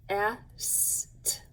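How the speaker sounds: "Est." as three separate sounds, "eh... s... t"; sounded out. Shown as a demonstration. Teacher giving pupils three sounds to write, sounded out separately. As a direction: break "Est." into short vowel, hiss of s, lone t. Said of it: "Put those three sounds right after it".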